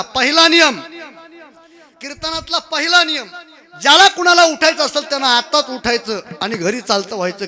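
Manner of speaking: loud, high proclamation; emphatic announcement. Real, not acted. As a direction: lay down the rule to the whole hall. Lays down first rule emphatically.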